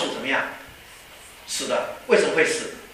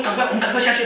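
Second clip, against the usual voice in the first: higher, mildly panicked urging; acted urgency, pitched up; urgent alarm; 7 semitones higher, 3 dB louder, faster than usual. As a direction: urge a descent in acted alarm. Pilot urges getting down fast.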